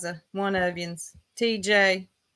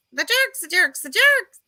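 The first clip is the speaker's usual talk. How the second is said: mock imitation; 10 semitones higher, 4 dB louder than usual. Mimicking Ziggy's catchphrase.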